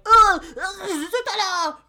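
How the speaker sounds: squealing, high-pitched hammed-up French chef; loud, high mock French cry of delight. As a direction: wail it out like an overwrought French chef. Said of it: "he's like".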